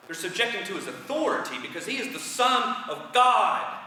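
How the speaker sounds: ordinary delivery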